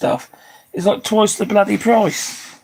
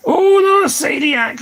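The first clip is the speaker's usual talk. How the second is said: high squeal of sympathy; mock dismay; 12 semitones higher, 4 dB louder, slower than usual. Real, not acted. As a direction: gasp it out, much higher. Sympathy on hearing of coeliac.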